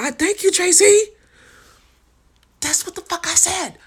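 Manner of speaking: high, loud and thrilled; excited. Real, not acted. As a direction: burst out in excited agreement. Excited agreement with comment.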